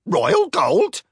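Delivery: high, loud squawking parrot. Acted. Mechanical parrot squawks the news. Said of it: "Twitter squawked".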